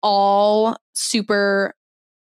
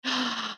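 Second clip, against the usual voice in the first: excited gasp; 4 semitones higher, 10 dB quieter than usual. Real, not acted. Excited reaction to pumpkin coffee.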